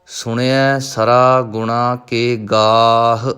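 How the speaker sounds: ਗੁਣਾ with its long ਣਾ held before ਕੇ, sung slowly; lengthened vowel demo. Shown as a demonstration. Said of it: "ਥੋੜ੍ਹਾ ਜਿਹਾ ਲੰਬਾ ਕਰੋ". (ਦੀਰਘ ਮਾਤਰਾ ਲੰਮੀ ਕਰਕੇ)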